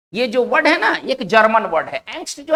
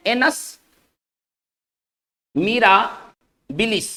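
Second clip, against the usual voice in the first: slow careful spelling-out; much slower than usual. Shown as a demonstration. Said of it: "introducing a Latin term".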